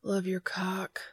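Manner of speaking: whispered, breathless moan; groaning whisper. A narrator's reading. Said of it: "Dean groaned".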